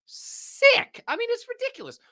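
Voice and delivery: very high breathy yelp; excited squeal. Real, not acted. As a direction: squeal over a great prize. Reacting to the giveaway.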